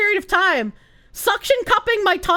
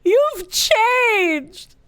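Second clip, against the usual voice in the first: hurt accusation; 3 semitones higher than usual. Acted. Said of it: "Give that woman the Oscar".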